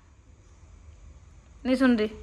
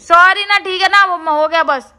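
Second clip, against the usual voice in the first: pleading sorry; 4 semitones higher, 7 dB louder than usual. Real, not acted. Pleading apology to sulking partner.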